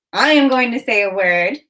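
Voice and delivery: normal talking voice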